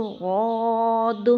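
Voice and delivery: غاد thick and slow; heavy slow غاد; correct dhad. Shown as a demonstration. Teacher models how we will say غاد. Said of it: "ایسے کر کے پڑھیں گے".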